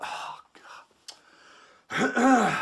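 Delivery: breathy "Oh" then a sharp high "God"; a fed-up groan of oh God; exasperated sigh. Shown as a demonstration. Trainer demoing a frustrated passenger's exasperated groan. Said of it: "That sort of".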